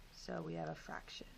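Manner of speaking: whispered aside. Real, not acted. Teacher's whispered aside to class.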